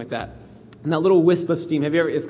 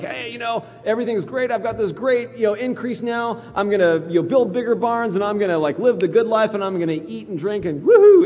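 higher, carefree party-guy voice; boastful rich man; 6 semitones higher than usual. Acted. Preacher playing the parable's rich man, carefree boasting. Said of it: "the parable of that guy".

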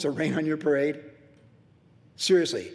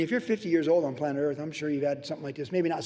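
ordinary talk

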